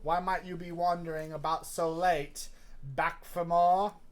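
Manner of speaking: put-on Cockney accent. Stranger questions Poe at night in Cockney. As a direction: keep the playful Cockney going, stretch the end. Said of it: "that's a good Cockney".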